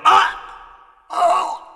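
high, sharp yelp of being shot; sudden shot cry. Acted. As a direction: cry out as the bullet hits. Enrico shot mid-sentence.

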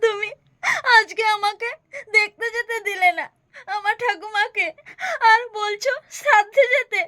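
crying, wounded accusation; weepy, hurt refusal; tearful reproach. Acted. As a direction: sob out the grievance in broken phrases. Acted tearful reproach over grandmother's death.

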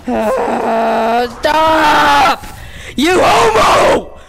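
frustrated shout. Real, not acted. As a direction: shout them off you. Gamer yells at enemies.

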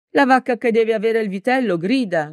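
ordinary delivery, nothing marked

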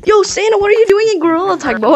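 hyped gamer bro. Real, not acted. Hyped teasing Santa player.

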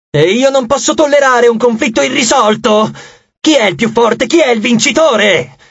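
high, imperious insistence; agitated demand. Acted. Goddess unable to tolerate unresolved conflict, agitated demand.

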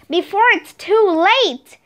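a touch of worried whimper; about to cry. A narrator's reading. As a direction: plead lightly, as if tears are near. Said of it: "sounded like she was about to cry".

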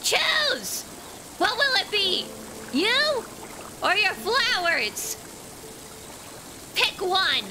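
hammed-up crazy man screaming at a villager; screamed threat. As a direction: yell the ultimatum like a madman. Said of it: "crazy man voice".

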